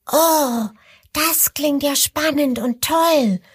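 high, cheerful little croc voice; delighted little crocodile. Acted. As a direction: call out with childlike joy. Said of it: "ruft das kleine Krokodil vergnügt".

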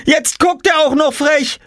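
put-on high, loud outraged girl's shout; high indignant tattling yell; screaming. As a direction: shout it out, scandalised. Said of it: "schrie Jule".

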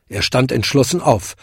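ordinary delivery

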